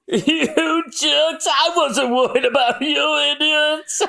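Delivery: very high, acted indignation; indignant outburst. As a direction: scold your friends in a squeaky-high pitch. Relieved friend scolds survivors.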